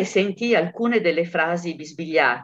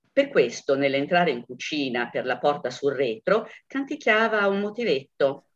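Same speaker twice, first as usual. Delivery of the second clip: ordinary delivery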